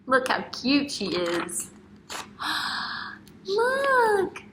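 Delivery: mock surprised gasp, then a very high "Look"; delighted gasp. Teacher showing young children a picture, with a hammed-up gasp of delight. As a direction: gasp as if amazed, then point.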